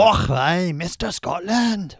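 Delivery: loud, exaggerated Scottish accent, slow on Scotland; a loud mock Scottish "Och, aye". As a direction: ham up a Scottish brogue. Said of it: "you have to do it in his accent".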